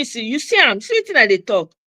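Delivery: ordinary talk, nothing marked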